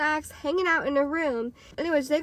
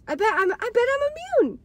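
high, sing-song smug insisting; cocky insistence; 11 semitones higher than usual. Retold or imitated. Teen telling friends she's immune, high and smug. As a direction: brag that nothing can touch you.

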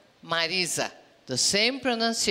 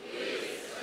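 whispered repeat; 11 dB quieter than usual. Shown as a demonstration.

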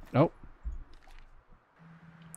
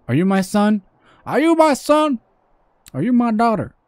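high, insistent southern questioning; southern accent; 7 semitones higher, 4 dB louder than usual. Pretend. Gamer asking a stranger about being kin, southern accent. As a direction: ask it as a hammed-up southerner. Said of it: "keep the southern accent".

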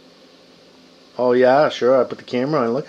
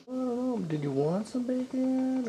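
put-on high, soft, slow baby talk; soft pet talk; 11 semitones higher, 8 dB quieter than usual. Owner teasing the dog with bacon, soft high coo. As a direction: coo it slowly up high, hushed.

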